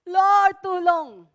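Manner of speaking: loud, sudden plea for help; mock desperate cry. Preacher mimicking a desperate person crying to God, loud. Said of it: "Pero pag hindi na".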